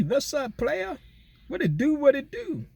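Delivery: very high, squeaky put-on hello; playful hyped greeting. Shouting out Motown on a livestream, playful hyped greeting. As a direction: jump way up into falsetto to greet a buddy.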